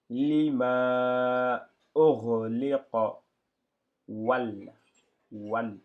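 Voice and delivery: lima, or, liqa, wal read slowly, loud and syllable by syllable; careful Arabic reading. Shown as a demonstration. Teacher reading the syllables aloud for students. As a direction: spell out each Arabic syllable. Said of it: "on utilise toujours les voyelles".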